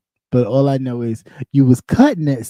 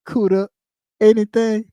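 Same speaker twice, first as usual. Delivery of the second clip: ordinary talk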